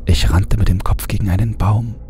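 plain reading voice

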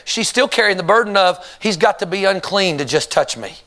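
ordinary talk

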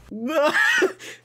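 bursting into high laughter. Real, not acted. Laughs at Jess.

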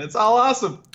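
high excited burst. Real, not acted. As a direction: gush it out.

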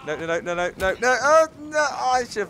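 no's climbing high and loud; panicked cry. Real, not acted. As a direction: cry out as it all goes wrong. Panics as the fight fails.

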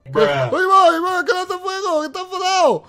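high, loud mock panic; frantic play-scared yelling; panicked alarm. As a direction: cry out as you flee the fire. Flees from fire-throwing Anger.

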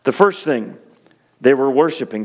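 ordinary delivery

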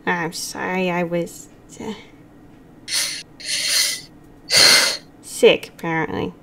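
low, soft, halting apology; hushed apology. Acted. Psychopomp apologizing to Gil, hushed and halting. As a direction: apologize quietly, with long hesitations. Quiet apology to hurt friend.

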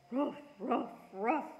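lightly voiced dog barking; mild woof woof voice; dog barks. A narrator's reading. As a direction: bark like a happy dog. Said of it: "Marley said".

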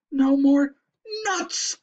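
high squeal of dismay; despairing dread. A narrator's reading. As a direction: wail it as a last thought. Character thinks it is the end.

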